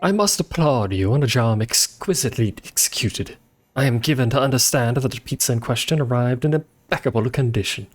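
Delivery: hammed-up posh British accent; shitty posh accent. Streamer reading a pizza customer's email aloud, hammed-up posh British accent. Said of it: "Sorry, I have to read that in the shitty accent".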